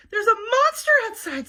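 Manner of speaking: frightened. Acted. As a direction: warn her sister in fright. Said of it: "said Baby".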